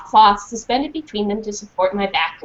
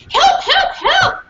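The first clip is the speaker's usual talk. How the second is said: frantic cry; 4 dB louder, slower than usual. Acted. Dog barking for help.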